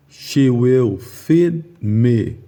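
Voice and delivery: long E drawn out slowly in feed; slow example sentence. Shown as a demonstration. Teacher reads the long E sentence very slowly. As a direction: read it slowly, stretching the long E.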